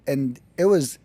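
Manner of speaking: ordinary talk, nothing marked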